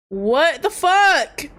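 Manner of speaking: high, very drawn-out outcry; shocked disbelief. Real, not acted. Player reacting to the scolding, shocked disbelief. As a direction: stretch every word in appalled shock.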